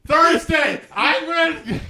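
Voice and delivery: high, loud excited yell; excited shout. Real, not acted. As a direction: shout it out with glee. Excited outburst.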